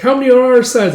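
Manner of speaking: put-on high, loud child-at-play voice; kid's excited call. Child counting teammates in play battle, excited call. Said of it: "are all playing pretend".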